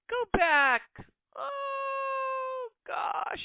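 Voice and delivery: hammed-up sob, voice breaking high; mock crying. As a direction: fake a wail of nostalgia. Mock lament for old music.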